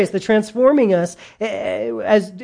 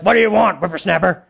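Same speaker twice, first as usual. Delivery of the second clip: put-on grumpy old person; faster than usual. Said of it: "And they're just angry".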